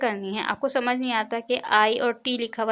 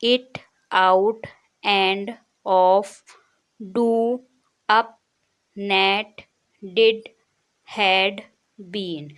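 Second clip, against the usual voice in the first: slow, loud English word list; slow read-through; 4 dB louder, much slower than usual. Shown as a demonstration. Teacher reading the list again, slow and loud. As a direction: read each word slowly for pupils to follow. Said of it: "एक दफ़ा और reading कर लेते हैं".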